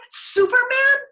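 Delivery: very high, loud disbelieving yelp; incredulous cry. A narrator's reading. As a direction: shriek it in disbelief. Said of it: "Clevenger cried".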